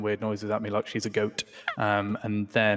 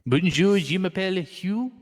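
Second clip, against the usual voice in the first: French greeting; 8 semitones higher, 4 dB louder, slower than usual. Acted.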